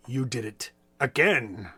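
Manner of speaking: a tired father's mild reproach, low; papa chicken. Acted. Papa scolds Chicken gently.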